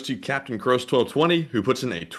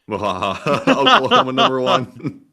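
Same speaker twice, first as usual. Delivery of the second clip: laughing; 3 semitones higher, 7 dB louder, much slower than usual. Real, not acted. Laughing joke.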